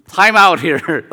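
loud, high cry of protest; startled objection. Retold or imitated. First-year student halting a stranger's prophecy, startled.

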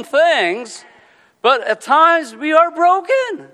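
loud, high, fervent preaching. Acted. Pastor preaching to the congregation, loud and fervent. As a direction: preach it out with full force.

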